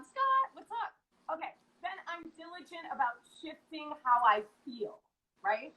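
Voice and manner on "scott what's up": high, sing-song hello; bright greeting. Real, not acted. Host welcomes viewer Scott back, high and sing-song. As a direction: call out a happy welcome.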